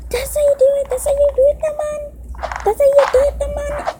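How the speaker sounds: very high, squealing triumph; excited self-cheer. Real, not acted. Kid landing a hard jump, squealing high cheer. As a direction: cheer yourself on after the jump.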